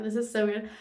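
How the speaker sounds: normal talking voice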